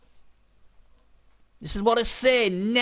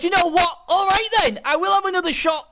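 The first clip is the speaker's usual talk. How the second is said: lightly voiced player, pitched higher; game player's voice; 11 semitones higher, 4 dB louder than usual. Commentator voices the player taking another shot, lightly acted. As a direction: act out the player gamely agreeing, raised pitch. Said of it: "He goes".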